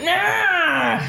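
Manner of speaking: high cackling yell of a flailing undead; hammed-up lich shriek. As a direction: shriek it out, half laughing. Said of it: "flails at you like".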